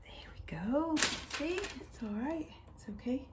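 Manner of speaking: breathy strain while getting up from chair. Real, not acted. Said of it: "I'm getting up slowly onto the chair".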